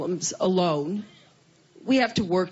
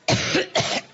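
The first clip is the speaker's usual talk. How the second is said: hard dry cough; cough, then excuse yourself; chesty cough. Real, not acted. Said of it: "excuse me".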